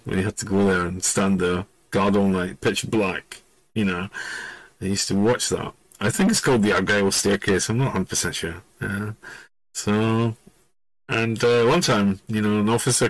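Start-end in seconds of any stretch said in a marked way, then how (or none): none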